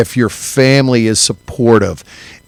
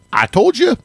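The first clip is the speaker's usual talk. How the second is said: playful shout; 12 semitones higher than usual. Retold or imitated. Warning coworkers, shouted.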